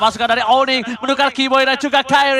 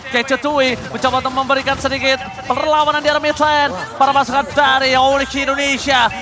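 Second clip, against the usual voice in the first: normal talking voice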